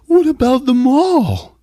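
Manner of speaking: high, slow put-on whine; drawn-out high protest in a put-on voice; whiny brother. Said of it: "and he's like".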